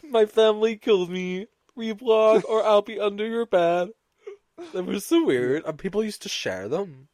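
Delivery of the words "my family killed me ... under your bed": higher, a hint of a robot; creepy chain post read like a machine; mock robotic voice. Host finishing the creepy chain post, mock robot voice. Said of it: "the robotic voice".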